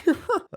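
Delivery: short high nervous laugh. Real, not acted. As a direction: let out a sharp anxious laugh.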